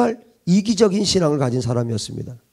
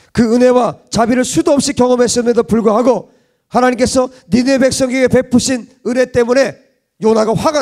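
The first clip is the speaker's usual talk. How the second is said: raised, forceful sermon voice; fervent preaching; 5 semitones higher, 4 dB louder than usual. Acted. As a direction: preach it out to the congregation.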